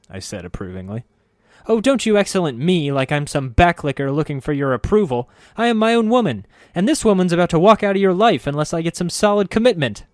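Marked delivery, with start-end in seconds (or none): 1.7-10.1 very high, loud hammed-up tirade; a wife at the end of her rope, overplayed; shrill mock fury. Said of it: "Time to let go of the anger".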